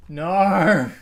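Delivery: sudden loud outburst; real shout. Real, not acted. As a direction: yell it in genuine shock. Reacting to game event, shouting.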